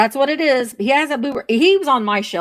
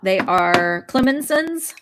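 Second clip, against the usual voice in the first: usual speaking voice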